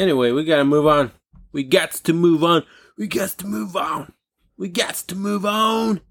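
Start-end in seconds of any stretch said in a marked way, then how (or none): 1.5-6.0 goofy, higher repeats, growing drawn out; silly put-on voice. Host moving the show on to rants, silly put-on voice. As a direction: play with the phrase, pitch climbing each time.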